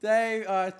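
loud, high outburst; exasperated shout. Real, not acted. Streamer answering a mate's teasing, shouting. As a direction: shout back at a heckling mate.

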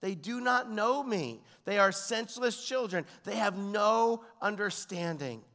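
high, slow, forceful declamation; impassioned scripture reading, pitched high; God lamenting. Acted. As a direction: declaim God's complaint against the people. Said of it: "He says".

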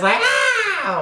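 quick high mock goat scream. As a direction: imitate a screaming goat with a sudden high shriek. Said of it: "them screaming ones".

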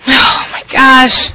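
loud drawn-out panic; panicked cry. Acted. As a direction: cry out in nerves. Panicking about the date.

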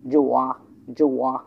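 জোয়া gliding from bottom to top; low-to-high tone on জোয়া. Shown as a demonstration. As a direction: lift the end of জোয়া for learners. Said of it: "নিচ থেকে উপরে".

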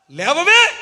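loud, high, sharp rise on one word; scolding shout. Real, not acted. Preacher scolding a woman glued to TV serials, scolding shout.

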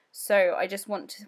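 ordinary talk

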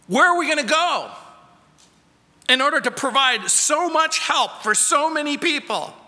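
worried disciples. Acted. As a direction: voice the disciples' panic about feeding everyone. Disciples' anxious question voiced.

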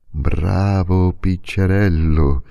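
ordinary delivery

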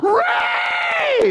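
high wailing cry of a name; grandma crying out loud. Retold or imitated. Imitating grandma wailing at the funeral, high cry. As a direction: let the name burst out as a grieving wail. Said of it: "my grandma cried out loud".

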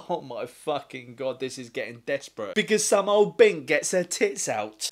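normal talking voice

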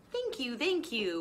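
a grateful stage bird acknowledging applause; bluebird singer. Acted.

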